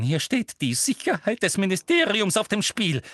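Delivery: hoch und laut, mit Nachdruck; sich hineinsteigernd. A narrator's reading. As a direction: steigere dich lautstark in die Rechtfertigung hinein. Umbridge steigert sich hinein. (aufgebrachte, hohe laute Stimme)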